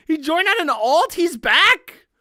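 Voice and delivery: high and loud, bursting with glee; excited reveal. Real, not acted. Streamer tells chat the troll is back, high excited. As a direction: announce it like big news.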